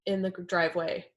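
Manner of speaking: ordinary talk, nothing marked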